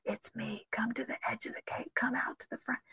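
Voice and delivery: soft unvoiced whisper of God's voice; God whispering softly to Elijah from outside the cave; gentle whisper. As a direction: breathe it gently, calling him out. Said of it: "in a gentle whisper".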